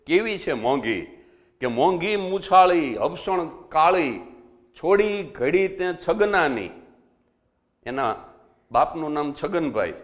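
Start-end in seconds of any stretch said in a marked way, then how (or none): 1.7-6.7 slow, louder chanted couplet recital; rhythmic folk verse delivery, higher and slow; doha recitation. Shown as a demonstration. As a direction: declaim the folk couplet, each line drawn out and landing hard. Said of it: "મેં વર્ષો પહેલા એક દુહો લખ્યો છે".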